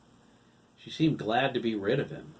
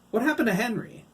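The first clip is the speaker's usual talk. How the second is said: demanding; 14 semitones higher, 8 dB louder than usual. A narrator's reading. Todd demanding.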